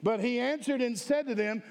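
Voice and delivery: ordinary talk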